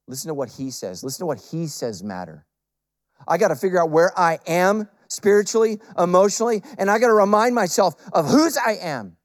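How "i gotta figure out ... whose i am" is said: high, loud preacher's build; loud sermon delivery over the lyrics; fervent preaching. Acted. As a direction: preach it out to the crowd. Preaching peak.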